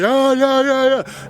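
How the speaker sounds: high, wordless-sounding squabble; mock two people yelling over each other; imitated yelling match. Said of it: "we're both yelling at each other".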